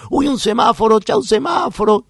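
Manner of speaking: put-on loud, high sing-out to a child; playful excitement. Therapist pointing out a traffic light to the boy, excited play. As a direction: exclaim high and loud, stretching the last word.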